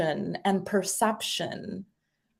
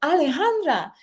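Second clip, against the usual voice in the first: higher, softened mother's call; mom calling name; 7 semitones higher than usual. Retold or imitated. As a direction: call the child's name as you walk in. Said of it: "mom walks in the door and she says".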